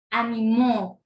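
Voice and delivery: Animó with a loud, long last syllable; a-ni-MÓ, force on the ending; stress on final MÓ. Shown as a demonstration. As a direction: hit the MÓ at the end of Animó. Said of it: "Pueden escuchar la diferencia".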